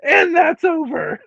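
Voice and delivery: an over-the-top mock wail; mock wailing announcer. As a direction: ham it up like a dramatic wail. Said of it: "then they're like".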